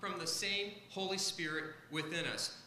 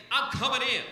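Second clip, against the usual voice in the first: bold push; 3 semitones higher, 7 dB louder than usual. Pretend. Bold faith pushing through.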